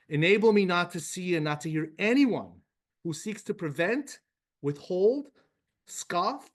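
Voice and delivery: fervent, rising prayer read aloud. Acted. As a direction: pray it out with growing conviction. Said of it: "Let's just read it together".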